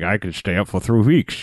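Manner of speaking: lightly mimicked actor voice; Sean Connery. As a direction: put on a hint of a movie-star dragon voice. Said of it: "Sean Connery spits on him".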